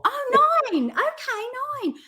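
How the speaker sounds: high, surprised "Oh, nine, okay"; dawning understanding from the customer; Essex customer realizing. Retold or imitated. Essex bar customers finally getting the price, high and surprised. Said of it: "they would go".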